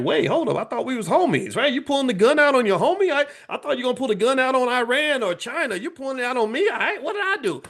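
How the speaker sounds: Canada whining to Trump in a high, hurt voice; hurt friend Canada. Retold or imitated. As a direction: play a betrayed homie, pleading. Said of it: "Canada's like".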